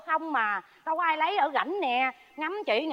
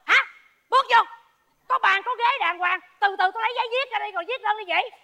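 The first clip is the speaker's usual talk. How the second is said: exasperated shout; 6 semitones higher, 4 dB louder than usual. Acted. Neighbor shouts mediating quarrel.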